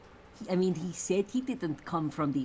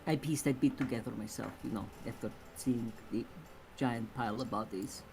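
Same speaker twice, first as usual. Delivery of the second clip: low, soft, matter-of-fact voice; calm; 6 semitones lower, 7 dB quieter than usual. Acted. Crew member explaining the pile of bodies to Ada, calmly. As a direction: state it quietly and calmly. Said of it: "And you say it so calmly".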